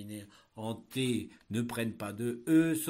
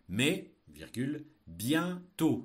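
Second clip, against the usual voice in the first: dictating; 2 semitones higher, 6 dB louder, much slower than usual. Shown as a demonstration. Teacher dictating a text.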